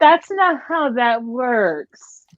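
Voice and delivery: very slow, spelled-out correction; slow scolding. Retold or imitated. Viewer correcting the movie, slow and deliberate. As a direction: drag it out word by word. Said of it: "I'm like".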